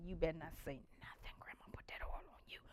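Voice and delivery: urgent hushed whisper. Retold or imitated. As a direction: hiss the warning so grandma won't hear. Whispering to sister at night.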